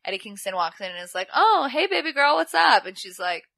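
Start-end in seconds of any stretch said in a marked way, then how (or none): 1.4-2.9 loud, high mock flirty hello; over-familiar greeting.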